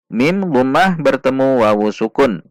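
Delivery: ordinary talk, nothing marked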